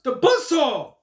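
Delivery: high, loud commentator shout; imitated yell. Taz announcing Tajiri's entrance, imitated excited yell. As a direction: yell out a wrestler's nickname. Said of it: "Taz yells out".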